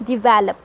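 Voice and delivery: develop as dih-VEL-up; light "di", strong "vel" in develop; stress on second syllable. Shown as a demonstration. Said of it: "आपको वीई पे ज़्यादा जोर देना है".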